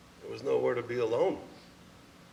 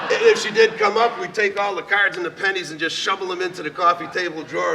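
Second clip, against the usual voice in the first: laughing; 6 semitones higher, 8 dB louder than usual. Real, not acted. Laughing retelling of hiding cards.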